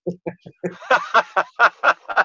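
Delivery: high, loud burst of laughter; hearty laugh. Real, not acted. Laughing at his own KFC joke, loud and high.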